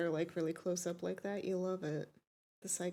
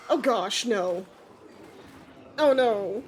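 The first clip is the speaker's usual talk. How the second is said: loud, real dismay; 8 dB louder, slower than usual. Real, not acted. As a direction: cry out in horror at the screen. Dismay at traumatic scene.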